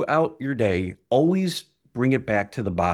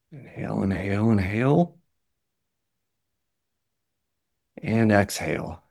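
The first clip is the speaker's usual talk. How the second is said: guided breathing. Acted. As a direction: talk them through each breath. Leads a guided breathing exercise.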